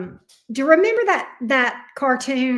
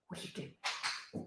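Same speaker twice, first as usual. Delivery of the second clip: slow, hushed question to the dog; whisper; 22 dB quieter, slower than usual. Real, not acted. Owner whispering slowly to her dog. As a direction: whisper softly as if sharing a secret.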